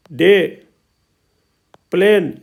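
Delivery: "डे", "प्लेन" each alone with a long ए; long ए vowel. Shown as a demonstration. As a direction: drill "डे" and "प्लेन", lengthening the vowel. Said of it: "ए का उच्चारण लंबा कर दिया जाता है". ("डे", "प्लेन" में ए लंबा करके)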